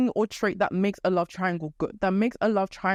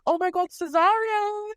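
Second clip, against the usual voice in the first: much higher, swooning mock squeal; hammed-up lovestruck Olivia; gushing excitement; 10 semitones higher than usual. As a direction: Olivia spotting her crush, high giddy gushing. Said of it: "and Olivia's like".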